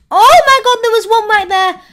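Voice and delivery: high, loud alarmed cry; panicked shout. Real, not acted. Gamer spotting a bomb beside her, loud panicked cry. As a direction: yell out at the danger.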